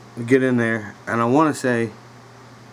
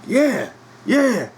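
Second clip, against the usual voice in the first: eager stranger; 13 semitones higher than usual. Retold or imitated. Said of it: "the dude was like".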